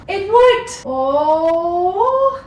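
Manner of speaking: high, loud "It worked!"; cheering the door finally clearing; excited cheer. Real, not acted. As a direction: burst out in delight.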